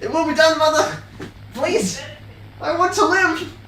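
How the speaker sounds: very high, desperate falsetto begging; melodramatic plea. Pretend. Pilot begs his mother to let him live, squeaky falsetto plea. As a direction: beg for your life in a squeaky falsetto.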